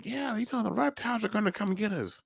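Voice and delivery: put-on high, childlike voice for a kid's claim; a kid warning the others the reptiles will come for them; mocking little boy. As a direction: play the naive little boy. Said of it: "the little boy was talking about".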